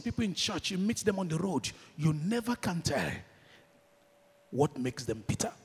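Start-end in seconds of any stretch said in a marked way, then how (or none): none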